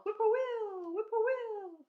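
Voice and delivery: high, loud birdcall; whippoorwill call. Retold or imitated. Reading the whippoorwill's call, high and loud.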